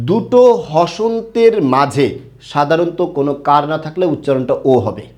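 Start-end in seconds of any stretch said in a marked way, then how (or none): 0.1-2.1 দুটো হসন্তের মাঝে drawn out, দুটো hit hardest; slow teacher emphasis on দুটো হসন্তের মাঝে. Shown as a demonstration. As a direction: stress দুটো and slow right down. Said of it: "মাথায় রাখতে হবে".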